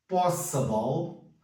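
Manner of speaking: possible said slowly as POS-sə-bal; slow model pronunciation. Shown as a demonstration. Teacher slowly models possible for students. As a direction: draw out possible, each part clear. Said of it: "model pronunciation".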